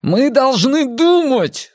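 high, drawn-out desperate shout; urgent cry over a panicking group. A narrator's reading. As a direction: yell slowly to make them listen. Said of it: "закричал Эрик".

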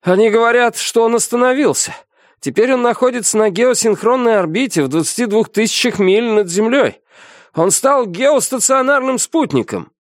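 high-pitched, urgent report; excited first mate. A narrator's reading. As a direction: keep relaying the news, high and agitated. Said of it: "первый помощник продолжал".

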